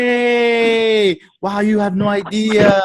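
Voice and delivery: high, loud and slowed; upbeat sales pitch voice; excited upline voice. Shown as a demonstration. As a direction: hype the product. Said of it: "And I'll be like".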